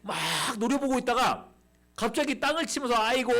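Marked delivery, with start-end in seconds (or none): 2.9-3.3 a hammed-up cry of 아이고, a little higher and louder; mock wailing. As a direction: wail 아이고 as the prophet starts to weep. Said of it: "막 울기 시작하는 거야". (엘리사가 울며 외치는 과장된 통곡)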